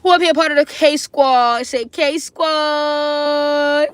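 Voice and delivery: rally the crowd; hype call. Real, not acted. Calling out to her live viewers, loud and excited.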